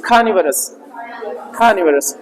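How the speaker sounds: खानिवरस with an aspirated kh at the start; खानिवरस, the k said with a puff of h; breathy kh start. Shown as a demonstration. Teacher: खानिवरस, क को ह के साथ. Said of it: "ह की sound रही".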